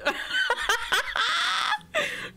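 high-pitched giggle breaking out; high laugh. Real, not acted. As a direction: burst into a high, surprised laugh. Streamer laughs at her own echo. (petit rire aigu)

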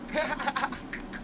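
high giggling laugh; burst of laughter. Real, not acted. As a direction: crack up laughing. Laughs at the accent argument.

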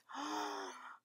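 a soft, staged gasp of surprise; mock gasp. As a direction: gasp as if amazed for the kids. Reacting to door opening.

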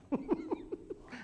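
high, scoffing burst of laughter; a short derisive laugh; mocking laugh. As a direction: laugh it off like a doubter. Mocking Mary's claim.

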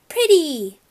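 put-on high, loud little-kid exclamation; small child showing off. A narrator's reading. Little brother shows big brother the poster, childishly proud.

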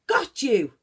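triumphant shout; cried out. A narrator's reading. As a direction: pounce with a cry. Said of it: "he cried".

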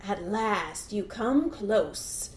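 slow, drawn-out alligator menace; stretched-out, lightly voiced predator; Mister Alligator. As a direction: savor catching your prey at last. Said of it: "said Mister Alligator".

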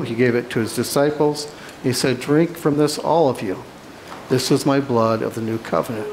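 ordinary delivery

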